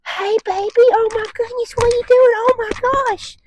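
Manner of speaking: put-on very high squealing baby talk, slowing at the end; gushing excited greeting. Shown as a demonstration. Trainer mimicking owners greeting a dog at home. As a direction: overexcite the dog on purpose. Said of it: "and it's".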